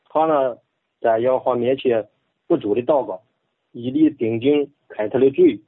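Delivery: Bible reading in Henan dialect; measured Henan dialect recitation. Shown as a demonstration. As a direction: read scripture in a rustic Henan accent. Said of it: "用河南话".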